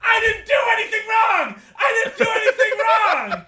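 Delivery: high frantic shouted denial; screaming. Acted. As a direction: yell it like a panicked alarm. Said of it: "And then it says".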